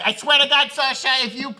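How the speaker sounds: high, loud put-on Isla accent; Isla Fisher accent. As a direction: do a mock-outraged wife in an Isla accent. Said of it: "this is my accent for Isla".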